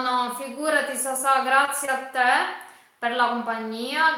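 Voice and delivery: ordinary talk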